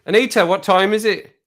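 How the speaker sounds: high, loud quick call; insistent call. Real, not acted. She's not doing it, calling the assistant insistently. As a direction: call out to a device that ignores you.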